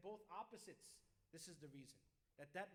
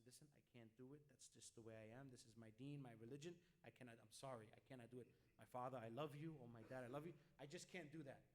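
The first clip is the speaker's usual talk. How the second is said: much softer, lower, tender refusal to a parent; nicely, gently; 6 semitones lower, 4 dB quieter, faster than usual. Shown as a demonstration. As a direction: refuse your father kindly and lovingly. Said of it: "tell them nicely".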